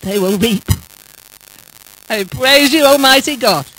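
normal talking voice

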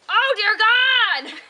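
loud, high, drawn-out horrified cry; shocked shriek. Real, not acted. Cleaning out a turkey, she shrieks in horror. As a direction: recoil in real disgust.

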